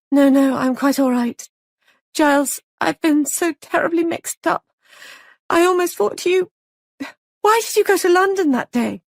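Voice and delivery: high overwrought female character voice; Molly, distraught. Acted. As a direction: play a frantic young wife pouring it out.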